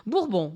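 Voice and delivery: "Bourbon" the French way, settled; French surname "Bourbon", falling at the end; French pronunciation. Shown as a demonstration. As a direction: say "Bourbon" with a nasal final "on". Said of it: "say it the French way".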